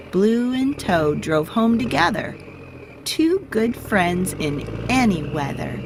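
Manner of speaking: plain reading voice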